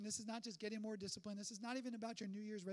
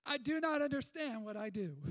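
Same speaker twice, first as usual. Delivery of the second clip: self-scolding; 3 semitones higher, 6 dB louder than usual. Retold or imitated. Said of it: "we thought to ourselves".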